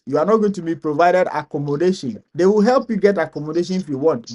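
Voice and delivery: ordinary talk, nothing marked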